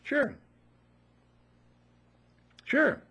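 high, loud insistent sure; emphatic affirmation. Real, not acted. Urging listeners to affirm, high loud "sure". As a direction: pump up the answer with conviction. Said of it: "Do we do it".

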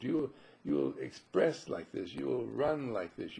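ordinary delivery

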